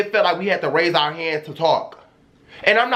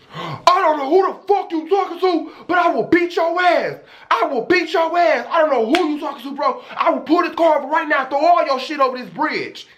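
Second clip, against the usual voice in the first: retold furious threat; 8 semitones higher than usual. The Uber driver threatening the passenger, high angry mock yell. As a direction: imitate the driver blowing up with threats. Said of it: "that's when he had said".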